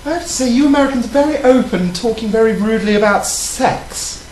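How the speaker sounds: prim, proper British lady voice; British accent, ladylike; put-on British accent. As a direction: play a posh English lady flirting. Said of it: "Your accent seems to change".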